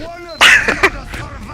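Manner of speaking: high-pitched giggling laugh; high, delighted cackle. Real, not acted. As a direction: burst into a long amused laugh. Amused by co-caster's "Delicious.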